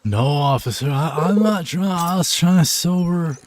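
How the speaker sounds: put-on high, loud pleading drunk; drunk driver pleading. Drunk driver pleading with the officer, high and loud. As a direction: beg the cop like a tipsy driver.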